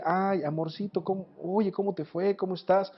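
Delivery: soft, coaxing imitation of a wife; butter someone up gently before asking a favour; quiet, affectionate greeting, imitated; sweet-talking wife. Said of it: "el tono de la voz de tu esposa".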